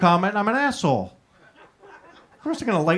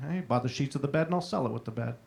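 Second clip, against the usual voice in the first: ordinary talk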